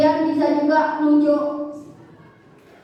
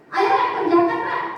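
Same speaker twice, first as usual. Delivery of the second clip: higher, laughing nudge to get to work; playful urging; 5 semitones higher than usual. Retold or imitated. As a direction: coax the class with a laugh. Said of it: "Misalkan Bapak Ibu di-, meminta untuk mengerjakan tugas". (ajakan santai sambil tertawa)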